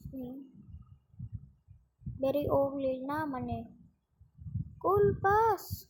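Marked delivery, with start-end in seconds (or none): none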